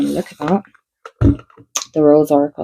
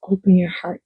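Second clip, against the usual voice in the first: ordinary delivery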